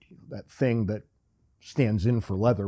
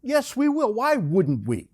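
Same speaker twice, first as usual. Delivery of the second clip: mock indignant; 11 semitones higher, 6 dB louder, slower than usual. Real, not acted. Co-host doubts the link.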